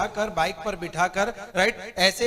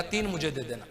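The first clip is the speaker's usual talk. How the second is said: laughing punchline; 6 semitones lower, 7 dB quieter than usual. Retold or imitated. To the friend, keep three, said laughing low. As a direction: lower and softer, laughing through it.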